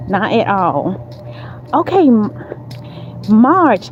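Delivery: usual speaking voice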